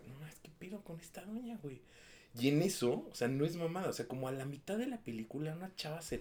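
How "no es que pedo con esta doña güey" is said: whispered aside in a dark cinema; an annoyed little whisper, barely voiced. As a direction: hush it to your date, annoyed. Muttering to girlfriend in cinema.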